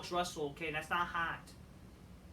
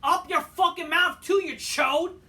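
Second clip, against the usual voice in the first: much higher, much louder raging yell; furious screaming; 11 semitones higher, 11 dB louder than usual. Real, not acted. Fan screaming insults at the TV, furious. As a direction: scream it with full rage.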